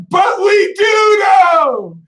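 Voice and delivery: high, very slow, loud shout; drawn-out shouted protest; defiant cry. Pretend. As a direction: stretch it out in triumph. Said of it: "we roll our eyes and we're like".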